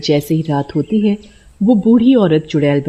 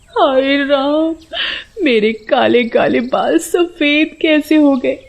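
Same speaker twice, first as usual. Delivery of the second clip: high, slow anguished cry; wailing woman; 8 semitones higher, slower than usual. A narrator's reading. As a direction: lament the ruined hair. (ऊँची, धीमी विलाप भरी आवाज़)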